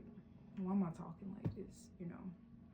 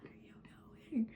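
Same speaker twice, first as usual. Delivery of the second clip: whispered mock alarm, a bit higher; panicked inner voice; 5 semitones higher than usual. Vlogger voicing her mind resisting change, hushed mock panic. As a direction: play your old habits freaking out in a hushed voice. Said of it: "is like".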